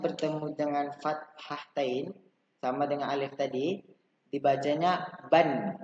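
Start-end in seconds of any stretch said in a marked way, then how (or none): 4.3-5.8 "ban" stretched slowly, ending on n; tanwin ban. Shown as a demonstration. Teacher models ban for pupils to repeat. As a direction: lean on ban with a slow, clear close. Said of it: "Dibacanya apa?".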